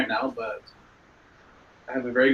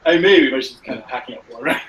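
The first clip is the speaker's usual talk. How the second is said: laughing; 3 semitones higher, 6 dB louder than usual. Real, not acted. Still laughing at the joke.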